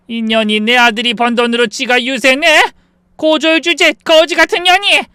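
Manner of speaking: loud tirade climbing very high; enraged insults. Acted. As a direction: hurl abuse while grabbing her hair. Mother-in-law grabs her hair, insulting.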